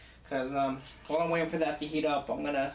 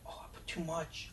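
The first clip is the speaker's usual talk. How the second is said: ordinary talk, nothing marked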